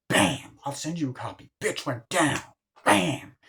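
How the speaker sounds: high, punchy mock gloating; gleeful re-enactment. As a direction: act out the knockout with relish. Re-enacting friends gloating over a punch.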